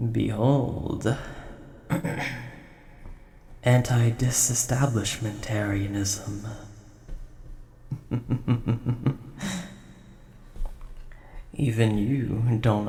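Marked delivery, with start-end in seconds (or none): 1.9-2.5 showy little throat-clear. Acted. Dragon about to show off language skills, throat clearing. 7.9-9.7 chuckle, pleased with your own big word; pleased laugh. Acted. Dragon showing off to a knight, self-satisfied chuckle.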